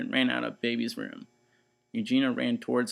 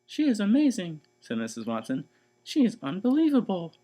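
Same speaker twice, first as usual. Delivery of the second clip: 1 delighted praise; 8 semitones higher, 5 dB louder than usual. Acted. Missus Watson praising Mercy.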